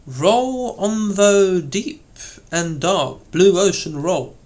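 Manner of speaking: high, sweeping stress on "Roll" and the final "roll"; grand declamation, leaning on "Roll"; compound stress. Shown as a demonstration. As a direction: swell "Roll on" high and full, then fall on the last "roll".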